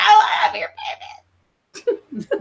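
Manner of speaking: high shout breaking into laughter; ugly cry. Retold or imitated. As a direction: shout it like a sobbing fan. Fan wailing at idol.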